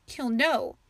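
mild sniffle behind the words; understated fearful sniff; sniffling. A narrator's reading. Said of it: "Ava sniffed".

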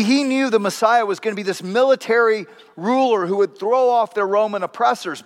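ordinary delivery

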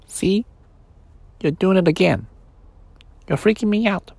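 a tiny voice begging not to be left; small toy voice. Acted. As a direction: toy bear begging the boy, small pleading voice. Sleepy's voice.

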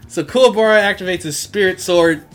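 usual speaking voice

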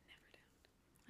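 slow, hushed whisper; a whispered spooky echo. Acted. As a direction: breathe the warning slowly.

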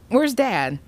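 high, perky question; chirping. A narrator's reading. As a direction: ask it in a cheery chirp. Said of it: "Sam chirped".

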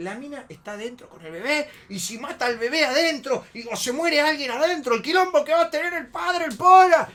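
frantic rant building from high to shouted; desencajado. Retold or imitated. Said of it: "Cuando está desencajado". (imitando al amigo desencajado, cada vez más fuerte)

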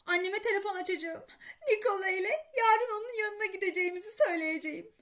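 high, quick, tearful mother. A narrator's reading. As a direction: threaten through tears to leave. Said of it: "ağlamaya başladı". (ağlamaklı, tiz ve hızlı)

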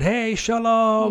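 high, slow, bright greeting; excited guide. Retold or imitated. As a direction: sing out the hello like an eager guide.

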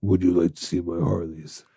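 low undertaker voice, hushed; hushed funeral director. Retold or imitated. Continuing the Undertaker bit.

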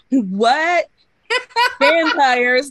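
loud, laughing mock scoff; mocking disbelief. Podcaster mimicking characters who doubt vampires, loud mocking disbelief. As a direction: blurt it out in fake disbelief, laughing. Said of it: "Eve and Ian being like".

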